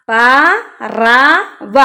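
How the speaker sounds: പറവ stretched very slowly, syllable by syllable; slow, careful teacher's reading of പറവ; drawn-out reading. Shown as a demonstration. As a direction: sound out പറവ slowly for children.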